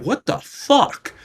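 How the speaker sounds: high, slow stunned what the fuck; disbelieving squeal at the sudden pain; high drawn-out shocked outburst. Retold or imitated.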